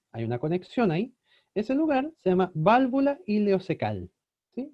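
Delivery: normal talking voice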